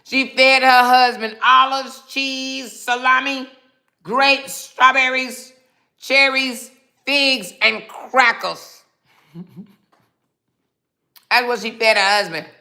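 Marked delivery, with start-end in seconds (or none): 0.0-6.7 louder and higher, slow and scornful; loud mocking outrage. Mocking a commenter's dinner to the viewers, loud scornful outrage. 7.0-8.8 slow mocking finish.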